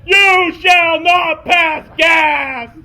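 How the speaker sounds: slow booming high commanding cry; Gandalf-style proclamation. Acted. Jason confronting the skunk horde, slow booming wizard shout. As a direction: thunder it like a wizard barring the way. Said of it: "I slammed it down and said".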